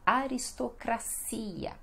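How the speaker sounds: "Aristocracia" as a-ris-to-cra-SI-a; Portuguese pronunciation. Shown as a demonstration. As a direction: stress and lengthen CI in "Aristocracia". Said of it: "e no português".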